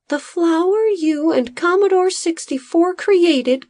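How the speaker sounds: high, light little assistant voice; a small worried helper explaining; Bodo's high voice. A narrator's reading. Assistant telling the farmer his master is affected, high voice. Bodo speaks as a character.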